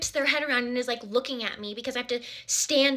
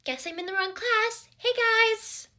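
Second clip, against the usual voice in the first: slow then higher awkward greeting; awkward panicked; 9 semitones higher, slower than usual. Retold or imitated. Wrong class in front of everyone, awkward high greeting. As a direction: play it awkward, voice climbing on hey guys. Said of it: "I was just panicking".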